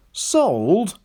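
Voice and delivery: high rising question; surprised. A narrator's reading. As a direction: caught off guard. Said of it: "the driver was surprised".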